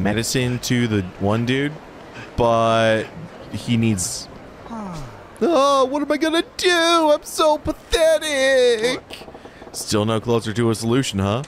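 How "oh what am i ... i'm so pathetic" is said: much higher, whimpering nervous guy; whiny lovesick man. Acted. Fool despairing to Majima about his problem. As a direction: play the pathetic lovestruck fool.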